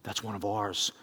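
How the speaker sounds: low, soft, solemn directive; God speaking. Retold or imitated. God sending believers to someone hurting, low and soft. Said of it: "God is saying".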